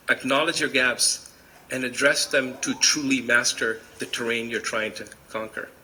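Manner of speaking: usual speaking voice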